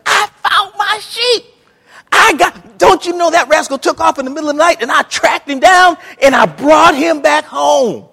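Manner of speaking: high, shouted bragging to the neighbours; joyful finder. Acted. Shepherd boasting to neighbours, high excited shout. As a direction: crow with delight about the sheep. Preacher acts out joyful sheep owner.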